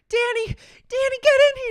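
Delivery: high, loud frightened cry for help; wife calling Danny in terror; panicked call. Acted. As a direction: shout for the husband in panic.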